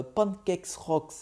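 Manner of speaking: English accent. Real, not acted. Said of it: "excuse de mon accent".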